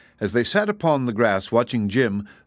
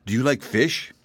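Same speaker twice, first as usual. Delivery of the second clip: calm wizard; 3 semitones higher than usual. A narrator's reading. Wizard offers fish calmly.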